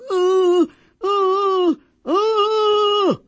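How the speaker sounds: high, strained groans of effort; straining groans. Retold or imitated. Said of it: "and she'd be going".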